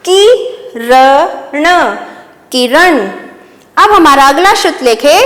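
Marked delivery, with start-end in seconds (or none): 0.1-1.6 very slow, syllable by syllable; slow dictation. Shown as a demonstration. Teacher dictating to young pupils, drawn out slowly. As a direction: stretch each sound for the class. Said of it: "तो ये कौन सा श्रुतलेख बन गया हमारा?".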